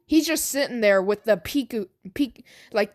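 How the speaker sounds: plain reading voice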